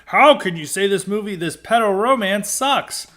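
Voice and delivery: indignant rising pitch, half-mocking; mock indignant protest. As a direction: scoff like an offended fan defending the film. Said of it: "people angrily defended that movie".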